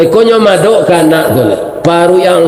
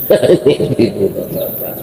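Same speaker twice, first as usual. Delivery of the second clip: laugh; 6 semitones lower, 6 dB quieter than usual. Real, not acted. Preacher laughs between story lines.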